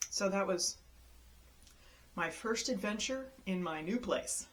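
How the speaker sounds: ordinary delivery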